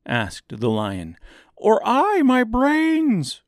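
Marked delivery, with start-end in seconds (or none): 1.6-3.4 high, loud, drawn-out wail. A narrator's reading. Scarecrow weeping for his promised brains, loud wail. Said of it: "wailed the Scarecrow".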